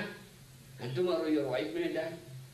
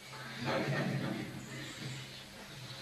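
soft, low, drawn-out chuckle; real low laugh, softly sustained; 10 semitones lower, 5 dB quieter than usual. Real, not acted. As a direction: chuckle quietly to yourself. Laughs after acting out the dilemma.

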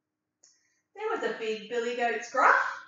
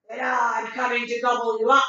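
loud, menacing troll voice; troll threatening the little goat; troll threat; 4 dB louder, faster than usual. Acted. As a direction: boom it out like a hungry troll.

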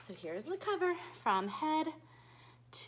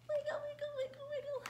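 playful sing-song; 13 semitones higher, 5 dB quieter, much faster than usual. Acted. Playful movement chant.

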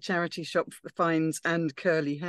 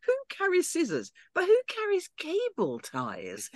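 much higher and slow, full of disbelief; Lena's incredulous quote; 14 semitones higher, slower than usual. Retold or imitated. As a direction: read the line in a high, slow voice, as if baffled. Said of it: "Lena is saying".

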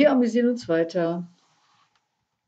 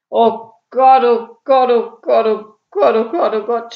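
loud, higher chant of dismay; despairing groan; 5 semitones higher, 9 dB louder, slower than usual. A narrator's reading. Burglar learns he is the third, loudly groaning. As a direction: moan it heavily, pounding each repeat. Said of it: "stöhnte Ede noch matter".

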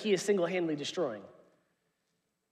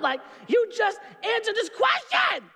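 hammed-up parental shout; mock yell; 13 semitones higher than usual. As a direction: yell at the kid in fake fury. Yelling at son over homework.

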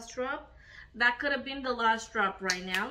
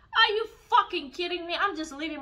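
higher, louder and fast in disbelief; angry outburst; 6 semitones higher, 4 dB louder, faster than usual. Real, not acted. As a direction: snap back at the influencers. Angry rant at influencers.

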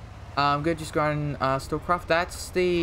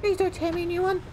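very high pleading squeak; mock pleading in a falsetto; squeaky plea; faster than usual. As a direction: beg in a tiny high voice. Pleading with game enemy.

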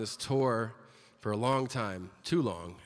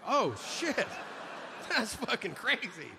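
mock shock; 8 semitones higher than usual. Listener reacts to the stabbing.

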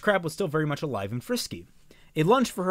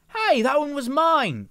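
indignant shout; 12 semitones higher, 6 dB louder, slower than usual. A narrator's reading. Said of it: "Velvet said".

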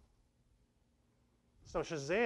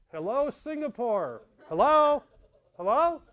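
higher, louder calls into the void, slowing on the first; calling out; 7 semitones higher, 9 dB louder, slower than usual. Pretend. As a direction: shout hello across the world and wait for an answer. Server calling out to Singapore.

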